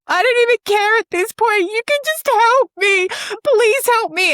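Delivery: high, loud, pleading on the edge of tears; desperate plea. Retold or imitated. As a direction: replay the moment you broke down and begged for help. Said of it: "had tears in my face".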